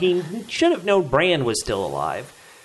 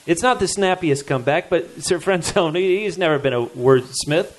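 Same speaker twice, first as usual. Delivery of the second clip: ordinary delivery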